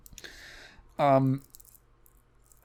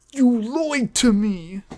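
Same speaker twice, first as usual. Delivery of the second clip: slow, higher, hammed-up hurt; mock dramatic accusation; 7 semitones higher, slower than usual. Movie character accusing his friend, slow mock drama. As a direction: drag it out like a soap opera.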